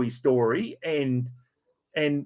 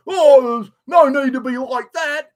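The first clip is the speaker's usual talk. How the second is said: high, much louder whiny mock voice; mocking whiny bloke; 9 semitones higher, 7 dB louder than usual. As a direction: send up the bloke as a wounded whiner. Mimics the bloke's hurt reply.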